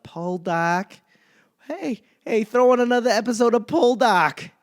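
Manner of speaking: high, loud Boston accent mock; a rowdy Boston guy calling out, hammed up. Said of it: "that Boston accent shows up".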